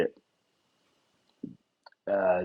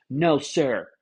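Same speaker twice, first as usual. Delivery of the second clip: scolding a pet; 6 semitones higher, much slower than usual. Retold or imitated. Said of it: "they'll talk to it like it's a fucking cat".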